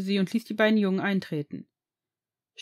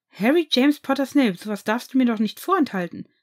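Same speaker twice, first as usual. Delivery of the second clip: higher and louder, full-name reproach; mock scolding; 4 semitones higher, 6 dB louder than usual. Boyfriend mock-scolding Harry for keeping secrets. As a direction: playfully scold him.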